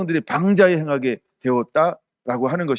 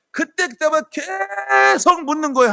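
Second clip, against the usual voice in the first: slow, high, ringing shout on 계속; preacher to the congregation, a slow, high, climactic cry; preaching shout; 11 semitones higher, slower than usual. Real, not acted. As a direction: hammer the point home to the congregation. Preacher driving home the point.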